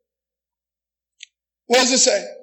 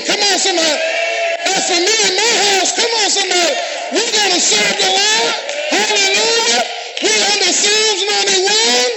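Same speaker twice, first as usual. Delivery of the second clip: shouting hallelujah to the congregation; preaching at full force; 6 semitones higher than usual. Acted. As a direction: lift the room with every line.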